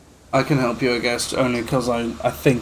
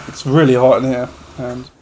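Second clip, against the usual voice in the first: ordinary delivery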